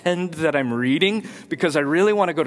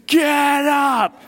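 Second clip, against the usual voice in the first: retold shout; 9 semitones higher, 4 dB louder than usual. Wife's command retold again.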